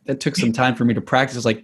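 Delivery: usual speaking voice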